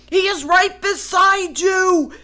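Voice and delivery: loud high warning; shouting. Acted. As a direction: yell urgently. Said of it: "shouted Wild".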